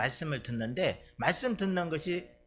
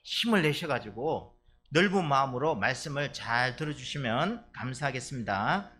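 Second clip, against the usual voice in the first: ordinary delivery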